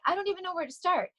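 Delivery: high, fast and flustered, recalling how overwhelmed she felt. Retold or imitated. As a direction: rush it out high, like being swamped by too much at once. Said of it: "then I'd be so overwhelmed".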